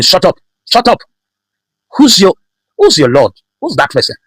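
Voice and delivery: high-pitched, scornful silencing; dismissive boss. Acted. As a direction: cut them off with high, punched questions. Boss silencing worker, acted quote.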